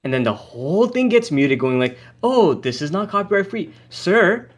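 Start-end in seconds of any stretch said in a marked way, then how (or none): none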